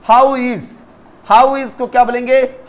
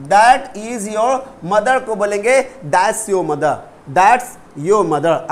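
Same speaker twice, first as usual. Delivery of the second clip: that is your mother, then that's your mother, slowly; teacher spelling out the change slowly; slow model sentence; much slower than usual. Shown as a demonstration. (धीरे धीरे समझाते हुए)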